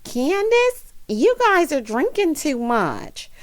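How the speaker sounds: high, drawn-out disapproving mother; mock-motherly reproach, stretched out; scolding mom impression. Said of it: "If my mom saw this, she would say".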